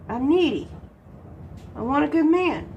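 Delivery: ordinary delivery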